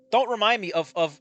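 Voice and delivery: usual speaking voice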